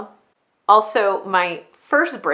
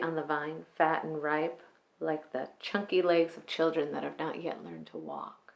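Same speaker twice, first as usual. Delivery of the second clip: soft, measured femme fatale, lingering over key words; quiet, controlled dramatic monologue with long pauses; 10 dB quieter than usual. Acted. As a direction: let phrases sink almost to a whisper, like a dangerous woman confiding.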